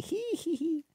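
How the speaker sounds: high giggle hee hee hee; giggling hee hee. Acted. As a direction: giggle at the silly story. Laughing at funny story.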